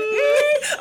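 high, squirming little "mm"; hammed-up awkward hum; awkward about compliments. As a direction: imitate shrinking from a compliment, high and loud. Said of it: "how awkward we are at receiving compliments".

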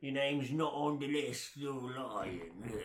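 a hint of a creepy villain; lightly put-on menacing accuser; snatcher voice. Imitating snatchers catching fake names, lightly menacing villain. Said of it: "and they're like".

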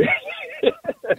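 bright, airy burst of laughter; high laugh. Real, not acted. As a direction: laugh it off warmly. Laugh after story.

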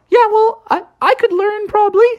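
high hurried frog defending himself; defensive Froggy. Acted. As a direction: stammer a hopeful excuse. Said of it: "Froggy was like".

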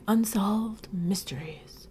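slow, whispery spooky voice. Pretend. Co-host playfully intoning the show's mystery topic, spooky whisper. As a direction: draw it out in an eerie whisper. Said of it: "like, a spooky voice when we say".

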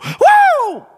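loud, very high-pitched whoop; whooping "woo". Shown as a demonstration. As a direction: let out a sudden preacher's whoop. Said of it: "I just stop and go".

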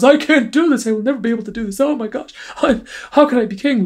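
high, far-off whining parody of a doubting hero; mock whiny Aragorn. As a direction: whine it like a self-pitying king-to-be. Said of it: "whine, whine, whine".

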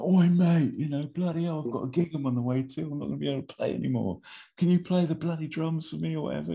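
high, strained Cockney-style rant; hammed-up high-pitched cabbie outburst; agitated cabbie. Acting injured cab driver's shouted quote.

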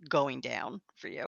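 ordinary delivery

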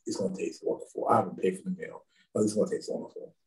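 quick, breathy near-whisper; hushed reassurance. Retold or imitated. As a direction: coax her along under your breath. Reassuring friend about the food.

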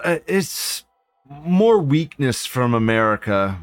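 normal talking voice

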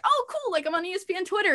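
high, fast giddy delight; bubbling over with news; excited. Retold or imitated. Delighted at being on Twitter.

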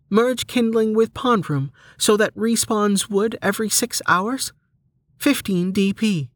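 high, measured read-out of options; menu system voice. Acted. Menu describes upgrades to Delta, high announcing voice. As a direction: list it like a game menu, high and even.

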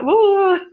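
high, loud mock yell; retold scream. Speaker retelling being trapped in the shower, mock scream. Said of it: "Well, I screamed".

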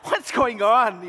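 high, slow, loud bewildered question; baffled. Retold or imitated. Pastor confused by the crying crowd, high bewildered ask. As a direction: sound completely lost. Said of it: "I'm like".

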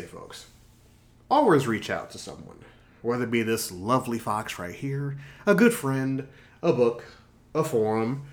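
normal talking voice

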